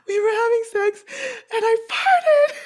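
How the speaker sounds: hammed-up sobbing confession; imitated sobbing. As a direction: wail it like a crying friend. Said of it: "I was like".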